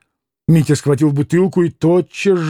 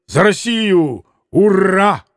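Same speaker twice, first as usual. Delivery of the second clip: raised-pitch toast and cheer; proclaimed toast; 4 semitones higher, slower than usual. A narrator's reading. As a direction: proclaim it, then hurrah. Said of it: "провозгласил он снова".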